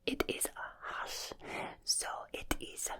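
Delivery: whispered, harsh staccato German accent. Shown as a demonstration. Showing ASMR listeners a tingly German accent, whispered. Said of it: "German accent".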